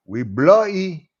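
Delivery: mock British accent, lightly done. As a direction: put on a posh British apology. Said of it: "gonna sound a little bit more like this".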